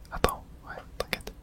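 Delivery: normal talking voice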